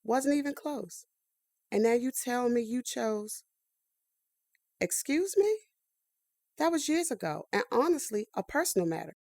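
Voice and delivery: ordinary talk, nothing marked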